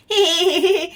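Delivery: short fond laugh; a quick amused chuckle; light laugh. Acted. Aunt laughing fondly at the baby.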